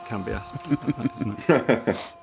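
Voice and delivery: put-on soft quick Geordie accent; just the name, said Geordie style. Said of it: "Good in a Geordie accent".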